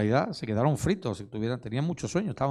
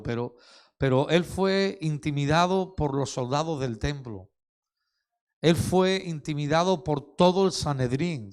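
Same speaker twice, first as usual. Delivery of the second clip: louder, rising preacher's build; fervent preaching, building; preaching climax; 3 semitones higher, 5 dB louder than usual. Acted. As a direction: drive each "Fue intimidado" home to the congregation.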